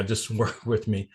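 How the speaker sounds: usual speaking voice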